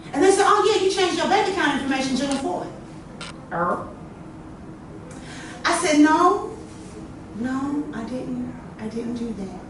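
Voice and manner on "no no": ordinary talk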